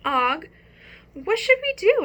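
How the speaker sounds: high, anxious little plea; worried hamster. Acted. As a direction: ask the frog for help, fretting. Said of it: "I asked my froggy friend".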